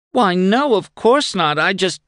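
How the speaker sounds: high, quick flustered denial; flustered woman. A narrator's reading. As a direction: protest too fast, caught out.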